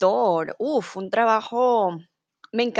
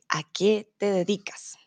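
whispered question. Shown as a demonstration. Teacher presents the question.

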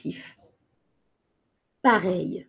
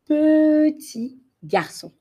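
petit drawn out, high and louder; stress on petit; 8 semitones higher, 4 dB louder, slower than usual. Shown as a demonstration. Teacher stressing petit for small boy. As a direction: stretch petit slowly up high.

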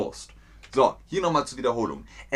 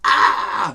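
mock outburst; 5 semitones higher, 8 dB louder than usual. Shown as a demonstration. Showing someone flipping out.

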